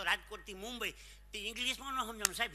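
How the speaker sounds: ordinary delivery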